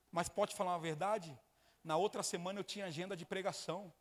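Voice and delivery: loud, driving sermon voice, hammering each phrase; fiery pulpit testimony shouted to the congregation; preaching at full force. Acted. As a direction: preach it to the church at the top of your voice.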